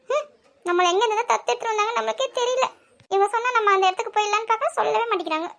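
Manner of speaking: put-on childish huff; sulky grumbling. Sulking daughter grumbles about being adopted.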